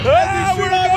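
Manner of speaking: high, loud thrill cry; thrilled on the drop; excited. Real, not acted. Coaster taking off.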